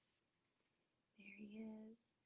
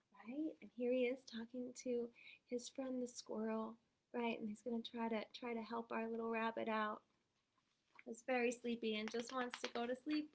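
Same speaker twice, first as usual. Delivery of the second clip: plain reading voice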